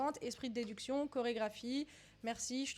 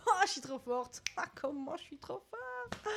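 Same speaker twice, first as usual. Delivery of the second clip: gloating delight; 5 semitones higher than usual. Real, not acted. Quiz player celebrates guessing Cuba right, delighted gloating. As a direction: revel in your own win, stretching it out.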